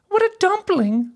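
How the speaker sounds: very high, teasing princess voice; mocking princess. A narrator's reading. Princess jeering at a too-short suitor, mocking. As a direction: belittle him in a high-pitched voice.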